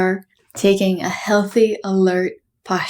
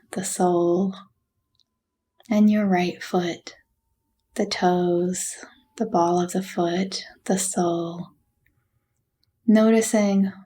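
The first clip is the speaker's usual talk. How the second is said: guided meditation. Acted. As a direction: gently guide them through a body scan, unhurried. Leads a body scan meditation.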